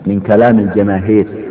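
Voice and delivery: usual speaking voice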